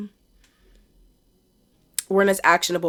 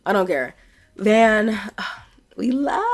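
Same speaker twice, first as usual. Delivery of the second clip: usual speaking voice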